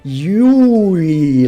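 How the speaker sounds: YUI drawn out very long with swooping rich vowels; over-rich vowels. Shown as a demonstration. Streamer mocks English speakers' Japanese, over-rich drawn-out vowels. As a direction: stretch "Yui" into one huge wobbly glide. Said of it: "too much richness in those vowels".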